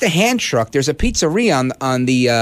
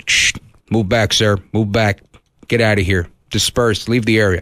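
low-pitched imitation of a cop on the PA; police loudspeaker; 8 semitones lower than usual. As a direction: drop the voice low for terse crowd-dispersal orders. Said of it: "on their loudspeaker over that walkie-talkie".

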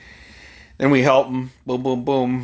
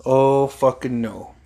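ordinary delivery

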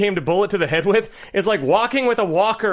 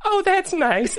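put-on old mother; 13 semitones higher, slower than usual. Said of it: "And she goes".

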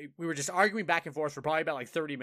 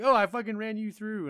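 higher, louder imitation of a toxic player; mock bragging; 7 semitones higher, 4 dB louder than usual. Toxic opponent bragging to the speaker, mock boastful. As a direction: crow it, mimicking a trash-talker.

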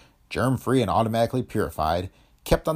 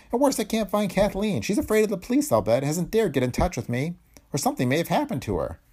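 high, anxious character voice; worried father; 11 semitones higher than usual. A narrator's reading. Father fearing for his missing daughter, worried. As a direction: voice a father's worry, pitched high.

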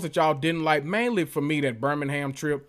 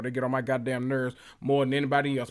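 usual speaking voice

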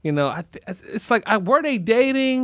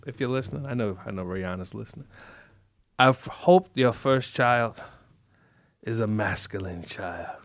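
Godfather impression; 9 semitones lower, 6 dB quieter than usual. Host blessing [name]'s baby, hushed Godfather voice. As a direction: rasp it quietly like a don giving a blessing. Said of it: "That's from The Godfather".

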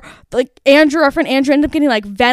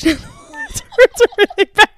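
laughing through the words, voice jumping high; real laughter; 12 semitones higher, 4 dB louder, faster than usual. Real, not acted. Admitting to the bleach streaks on her walls, laughing. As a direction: break up laughing as you admit it.

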